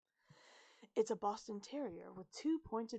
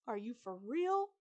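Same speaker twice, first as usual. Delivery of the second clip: incredulous question, louder and intense; tense, hissed challenge; hissed disbelief; 3 semitones higher, 7 dB louder than usual. A narrator's reading. Travis to his friend before she peeks, hissed disbelief. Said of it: "Travis hisses".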